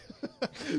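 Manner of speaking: high breathless witch's cackle. Pretend. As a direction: cackle like a wicked old hag. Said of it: "ton rire de sorcière".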